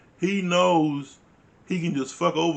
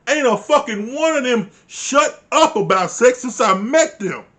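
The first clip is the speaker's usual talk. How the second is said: loud, high indignant tirade; acted outrage, hammering each point loudly; outraged comeback; 6 semitones higher, 6 dB louder than usual. Voicing Paige's comeback on his privacy claim, outraged.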